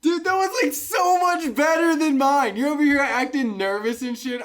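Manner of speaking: high, loud incredulous praise; amazed outburst. Real, not acted. Cohost amazed by the friend's British accent, loud outburst. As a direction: blurt it out, astonished and accusing.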